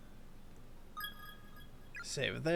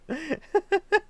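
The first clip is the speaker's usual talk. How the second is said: burst of laughter; 11 semitones higher, 5 dB louder than usual. Real, not acted. Laughs after bird scare.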